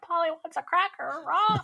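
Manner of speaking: high squawky bird voice, rising; parrot voice. Acted. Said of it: "that was my parrot voice".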